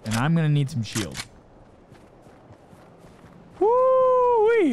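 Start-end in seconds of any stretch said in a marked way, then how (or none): 3.6-4.7 high, loud celebratory cry; gleeful whoop. Real, not acted. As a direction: whoop with joy. Arriving at base.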